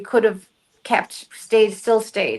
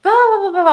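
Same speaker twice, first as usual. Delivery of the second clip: high, loud babble of voices; rapid nonsense chatter, pitched up; imitated chatter; 11 semitones higher, 6 dB louder, much faster than usual. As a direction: mimic a crowd talking over itself. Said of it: "all these voices and these people talking".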